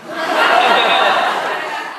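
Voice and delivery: loud, high laughing burst. Real, not acted. Voice actor laughing at his own advice, loud laugh. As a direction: laugh big at the joke. Laughs after saying don't.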